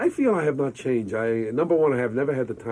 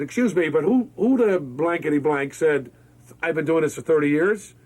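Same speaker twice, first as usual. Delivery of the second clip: indignant retold demand; 5 semitones higher, 4 dB louder than usual. Retold indignant challenge to writer.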